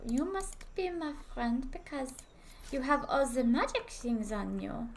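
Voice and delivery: put-on French accent. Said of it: "I must speak in French accent".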